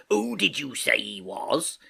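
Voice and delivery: slow sailor's question; Long John Silver. Acted. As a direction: ask in a drawling seafarer voice.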